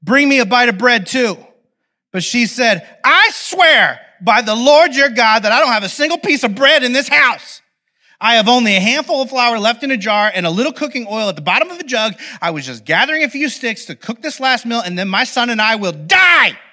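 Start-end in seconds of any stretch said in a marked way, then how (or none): 0.0-1.4 raised, higher call. A narrator's reading. Prophet to the widow fetching water, calling out. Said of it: "he called to her". 3.0-16.6 high, loud dramatic protest; exasperated outburst. Acted. Starving widow refusing the prophet's request, exasperated outburst. Widow's desperate oath, acted out.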